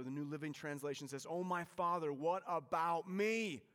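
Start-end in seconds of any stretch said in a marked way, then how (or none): 1.3-3.6 loud, high desperate plea, slow on what about me; begging. Retold or imitated. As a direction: cry out to his father for the blessing. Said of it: "he begged".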